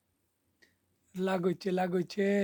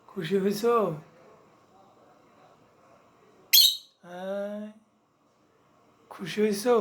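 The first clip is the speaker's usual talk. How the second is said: excited pet talk; 2 semitones higher, 5 dB louder than usual. Real, not acted. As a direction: cheer the pet up with bright rising questions. Excitedly asking pet if happy.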